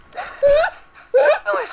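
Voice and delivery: very high, loud, laughing call of the name; giggling Trixie voice. Acted. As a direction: squeal the name through laughter.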